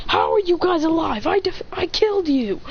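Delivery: high, disbelieving acted protest; incredulous hero. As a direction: demand answers in mock disbelief. Acted hero confronting revived villains.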